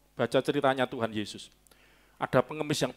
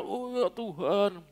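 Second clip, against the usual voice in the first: slow, raised, over-composed prayer; mock arranged prayer; 5 semitones higher, 3 dB quieter, much slower than usual. Preacher mocking over-arranged prayers to God, slow and affected. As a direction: draw it out like a carefully worded prayer. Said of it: "diatur kata-katanya".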